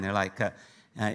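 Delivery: normal talking voice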